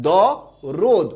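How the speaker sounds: দ, pause, then রোদ with a rounded o; syllable-by-syllable pronunciation. Shown as a demonstration. Teacher modelling the answer for students, syllable by syllable. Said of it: "উচ্চারণটা কি?".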